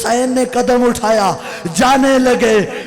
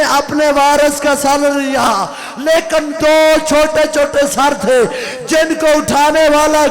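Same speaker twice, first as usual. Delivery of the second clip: building lament; 3 semitones higher, 4 dB louder than usual. Acted. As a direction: drive the mourners to tears.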